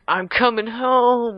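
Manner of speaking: high, drawn-out imitation of a homeward shout; triumphant mock cry. Guy in the Russian lander heading home, triumphant mock cry. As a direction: call it out long and high.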